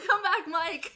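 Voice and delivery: laughing. Real, not acted. Laughing call to co-host.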